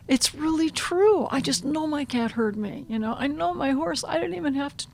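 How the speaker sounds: high, earnest amazement; amazed student. Acted. Voices amazed students in class.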